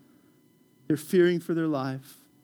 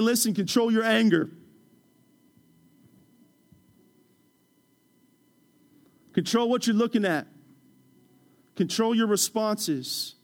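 higher, firm commands, slowing at the end; commanding God. Acted. God telling the congregation to control themselves, firm.

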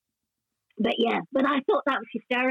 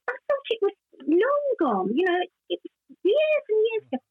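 high protest; 9 semitones higher than usual. Retold or imitated. Protesting to her son.

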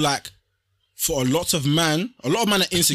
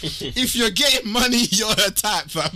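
mock reading voice; 3 semitones higher, slower than usual. Shown as a demonstration. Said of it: "Sorry, I have to read it out".

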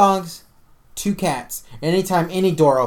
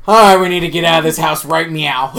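louder, half-joking alarm; mock panic; 9 dB louder than usual. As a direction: announce the escape with comic urgency. Retold panic decision.

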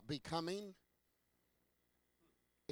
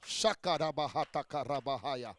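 speaking in tongues; 4 semitones lower than usual. Real, not acted.

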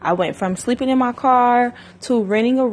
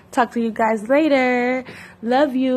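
cheery sign-off; 5 semitones higher than usual. Real, not acted.

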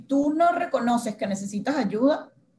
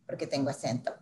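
soft, hushed thought to herself; inner thought; 7 semitones lower, 9 dB quieter than usual. Retold or imitated. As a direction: say it under your breath, to yourself. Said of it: "y yo en mi cabeza". (pensamiento en voz baja)

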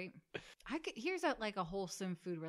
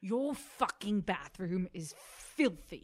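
louder, slow disgusted chef scolding; Ramsay berating; 4 dB louder, slower than usual. Pretend. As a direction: scold the filthy bathroom like an angry chef. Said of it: "things you think Chef Ramsay".

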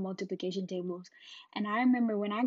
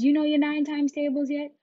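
high, loud challenge; demanding teacher; 6 semitones higher, 7 dB louder than usual. Retold or imitated. Teacher to pupil at end of day, demanding. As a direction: mimic a teacher's pointed question, pitched up.

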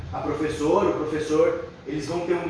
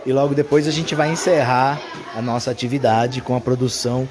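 announcer voice; 3 semitones lower, 4 dB louder than usual. Acted. Closing announcement for video.

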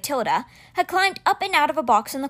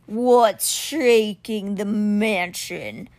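growling beast; much slower than usual. Acted. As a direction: growl it out slowly like a huge winged cat. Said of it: "Simber growled".

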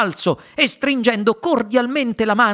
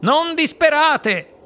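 higher, slow, grand exclamation; exclaiming; 9 semitones higher, slower than usual. Acted. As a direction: reassure them with a flourish. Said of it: "esclamò il signor Wonka". (esclamazione alta e lenta)

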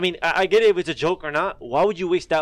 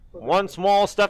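astronaut quote; 5 semitones higher, much slower than usual. Retold or imitated. Quoting moon landing line.

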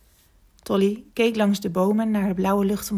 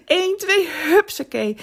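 high, loud bouncy count; gleeful ups-a-daisy; playful heave-ho; 9 semitones higher, 6 dB louder than usual. A narrator's reading. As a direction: call it out like launching a swing. Elephant swings himself up playfully.

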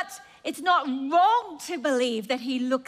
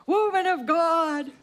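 louder, proud calling out of a title; God calling out; 3 dB louder than usual. Acted. God naming his people with pride. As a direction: call them by their new name. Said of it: "he looks at you and says".

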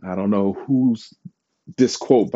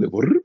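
phone buzz; 4 semitones higher than usual. Retold or imitated. Imitating phone notification buzz.

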